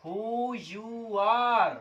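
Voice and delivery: each word long and drawn out; very slow dictation. Shown as a demonstration. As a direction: dictate the words slowly. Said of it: "कैसे बनाएंगे guys". (बहुत धीरे खींचकर लिखवाना)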